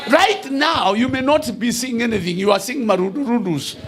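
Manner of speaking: shouted sermon declaration; full-force preaching. Acted. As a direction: thunder the promise over the church.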